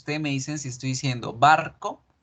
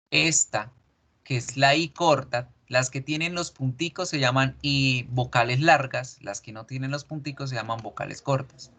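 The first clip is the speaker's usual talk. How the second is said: ordinary talk, nothing marked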